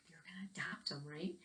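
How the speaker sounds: hushed, breathy whisper; whispered aside. Acted. Teacher whispering a guess to the children, hushed. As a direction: lean in and whisper it to the kids. Aside during the adoption scene.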